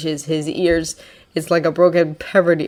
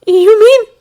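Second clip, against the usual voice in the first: tearful surprise; 12 dB louder than usual. Acted. Boy surprised, hopeful.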